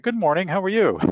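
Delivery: high-pitched, sunny retold hello; mock cheery greeting. Walker greeting the terrified man, exaggeratedly cheery.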